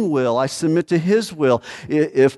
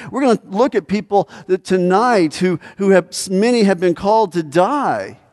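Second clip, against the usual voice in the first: preaching at full force; 7 semitones higher, 4 dB louder than usual. Acted. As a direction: hammer home the cost of following.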